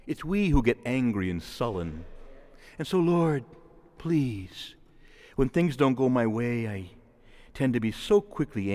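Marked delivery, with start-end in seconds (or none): none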